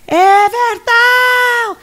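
long, high, drawn-out yell of a name; mom yelling a name. Retold or imitated. Mother calling her son from inside the house, long high yell. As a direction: call him like a mother shouting from inside the house. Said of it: "ela gritava assim".

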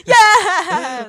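loud, high whoop of yes; delighted shout; excited cheer. Real, not acted. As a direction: cheer it out at full volume. Cheering the segment announcement.

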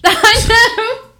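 loud, high laughing outburst; high-pitched, cracking-up exclamation; laughing agreement. Real, not acted. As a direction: burst out laughing as you agree. Cracking up at co-host's remark.

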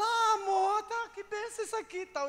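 high, loud delighted wife; imitating a wife suddenly thrilled; mock gushing. Wife gushes on finding real gift.

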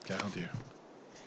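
very soft, slow and hollow; sinking low. Shown as a demonstration. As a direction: draw it out, fading down. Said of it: "Toujours plus bas".

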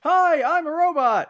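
high, loud monotone robot, drawn out; robot impersonation. Pretend. Joking to co-hosts about robots impersonating robots, exaggerated robot voice. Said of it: "here's my robot inter-, impersonation".

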